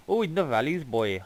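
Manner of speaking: put-on Welsh accent. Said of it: "that was Welsh for you guys".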